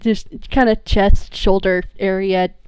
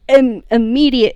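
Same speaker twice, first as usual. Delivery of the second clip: ordinary delivery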